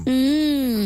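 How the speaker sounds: high, slow alien voice. Pretend. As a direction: play a munching space alien. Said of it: "en disant".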